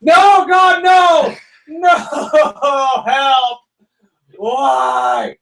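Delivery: high, loud anguished yell; desperate shouted wail; screaming in despair. Retold or imitated. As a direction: scream it at the top of your lungs like your team just lost. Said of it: "I just screamed at the top of my lungs".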